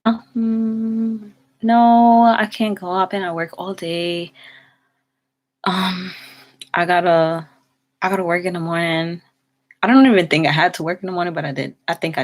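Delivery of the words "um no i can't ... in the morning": mock excuse. Telling the friend why she can't go, in a mock excuse voice. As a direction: act out brushing off a date invite. Retold refusing friend's movie invite.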